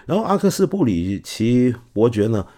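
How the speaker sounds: normal talking voice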